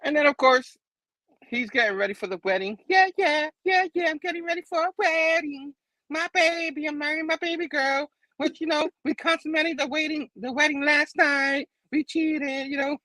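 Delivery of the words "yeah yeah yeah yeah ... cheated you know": high-pitched singsong gushing; giddy groom. Retold or imitated. Groom getting ready for his wedding, gleeful high voice. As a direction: crow about the wedding in a much higher put-on voice.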